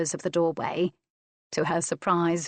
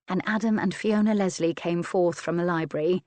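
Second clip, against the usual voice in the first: ordinary delivery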